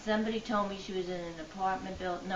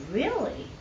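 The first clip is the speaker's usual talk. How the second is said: surprised; 9 semitones higher, 7 dB louder than usual. Retold or imitated. Hearing sister is found.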